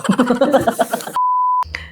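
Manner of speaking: high laugh after a jab. Real, not acted. Laughs after mocking a comment. (mataas na tawa)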